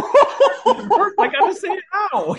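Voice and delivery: high, slow, laughing repeat; retelling dad's line while cracking up; laughing quote. Retold or imitated. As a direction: squeal it through laughter. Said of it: "This is the kind of conversation".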